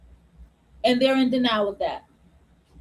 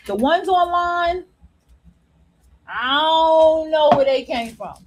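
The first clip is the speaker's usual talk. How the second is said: exasperated drawn-out; 2 semitones higher, 3 dB louder than usual. Real, not acted. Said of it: "exasperated, slow, louder".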